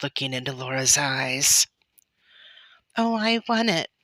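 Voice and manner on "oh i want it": put-on high-pitched child's begging; pleading, high little-girl voice. A narrator's reading. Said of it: "Laura begged".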